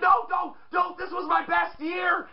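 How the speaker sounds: high protesting yell; hammed-up plea to stay; mock shout. Kofi Kingston refusing to leave his best year. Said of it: "He's like".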